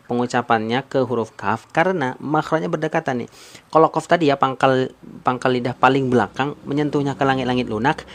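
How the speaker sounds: normal talking voice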